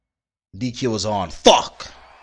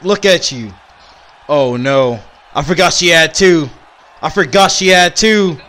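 ordinary talk, nothing marked

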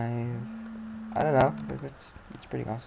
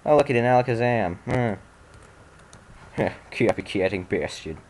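ordinary talk

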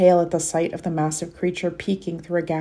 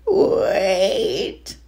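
loud, pleading creature cry; troll voice; 2 semitones higher, 3 dB louder than usual. Acted. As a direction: call out like a desperate troll. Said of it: "Your troll voice is on point".

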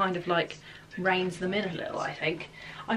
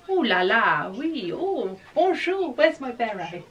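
hammy French voice with oui and bonjour; playful fake French; mock French accent; 2 semitones higher, 5 dB louder, slower than usual. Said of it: "Very French, isn't it?".

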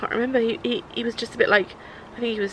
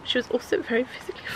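ordinary delivery

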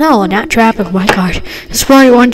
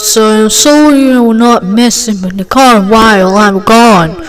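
ordinary talk

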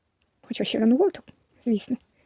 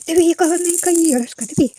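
high, loud cooing over a toy; bubbly motherly delight; playful baby talk; 7 semitones higher, 8 dB louder than usual. Pretend. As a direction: excitedly show it to a baby.

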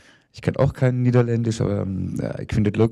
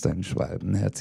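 "dein Schwalbenherz" repeated softer, like a fading echo; 4 semitones lower, 7 dB quieter than usual. Acted. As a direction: echo it back, quieter. Repeated line in poem.

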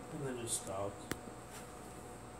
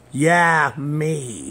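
loud exclamation; 4 semitones higher, 15 dB louder than usual. Real, not acted. Loud joking retort.

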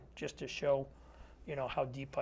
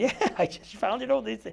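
high, laughing through the words; laughing reply; 7 semitones higher, 6 dB louder than usual. Retold or imitated. Answering the homeowner's question about the badge, laughing.

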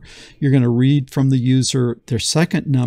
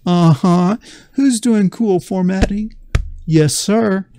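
higher, louder silly self-praise; playful bragging; 5 semitones higher, 3 dB louder than usual. Acted. Teacher praising his own formatting, playful bragging. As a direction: show off like a kid proud of himself.

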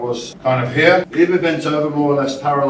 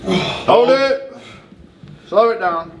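coach shouting cues; 7 semitones higher, much slower than usual. Real, not acted. Coach cueing a lift.

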